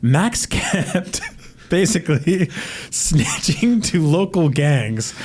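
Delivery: giggling through the words, slowed; can't stop laughing while telling it. Real, not acted. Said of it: "I can't say this without laughing".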